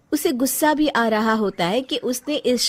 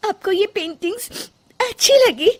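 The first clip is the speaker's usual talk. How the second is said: high, halting, sniffly question; small high voice, still weepy; tearful hope; 12 semitones higher, 3 dB louder than usual. Acted. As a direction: ask timidly after crying. Tearful wife asks hopefully.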